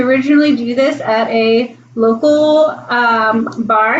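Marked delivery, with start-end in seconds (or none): none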